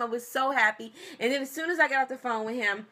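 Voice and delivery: ordinary talk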